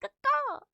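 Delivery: high loud cawing bird call; crow caw. Pretend. Greeting a bird-named viewer, loud high crow caw.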